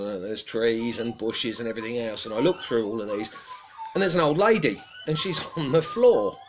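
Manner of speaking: ordinary delivery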